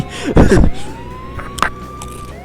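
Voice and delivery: high, shrieking laugh. Real, not acted. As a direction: let out a squealing laugh. Laughing at confusion.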